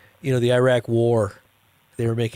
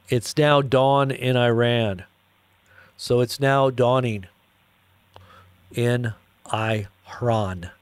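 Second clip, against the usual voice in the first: slow dramatic; much slower than usual. Shown as a demonstration. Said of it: "how do they say it?".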